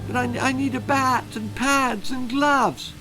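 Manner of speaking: put-on high, stammering frightened boy; quaking. A narrator's reading. Terrified boy pleading with the bully, high and stammering. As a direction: tremble through the excuses. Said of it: "quaked Timothy".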